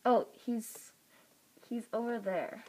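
ordinary delivery

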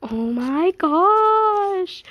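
very slow, loud stretched-out squeal; fangirl scream. Real, not acted. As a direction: let the gosh soar, overwhelmed. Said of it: "I'm trying not to scream".

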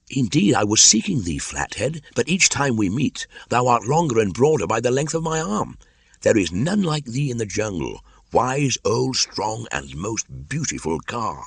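put-on high, eager young flatterer; butter up the offended snake in a lifted voice; high-pitched coaxing praise, easing off at the end; flattering Mowgli.

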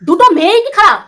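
loud rhetorical outrage. Real, not acted. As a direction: throw the question back harder. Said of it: "repeated louder".

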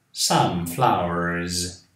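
"flowers" slowly, ending on a voiced buzzing z; z sound in flowers. Shown as a demonstration. Teacher to Brazilian students, slow voiced z in flowers. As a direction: stretch "flowers" so the final s buzzes like z. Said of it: "Som de Z depois do R".

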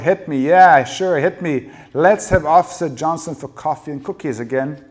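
very loud, high, goading dare; furious sarcastic shouting; bellowing. A narrator's reading. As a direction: bellow it, daring him to strike. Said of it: "Rachel bellowed".

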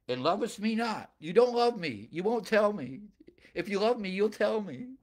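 high, emphatic wifely reproach; mock pleading. Samson's wife pressing her husband to tell, mock pleading.

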